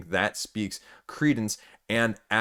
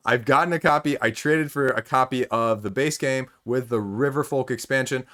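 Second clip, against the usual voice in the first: ordinary talk, nothing marked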